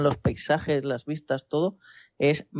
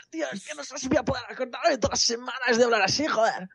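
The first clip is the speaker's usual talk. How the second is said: high, strained squeaky voice; 7 semitones higher than usual. Pretend. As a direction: squeeze it out high and tight. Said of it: "debe de doler eso luego".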